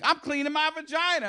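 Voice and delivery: put-on high, innocent three-year-old voice; sweet and matter-of-fact like a small child; innocent little girl. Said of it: "Very innocently, she's like".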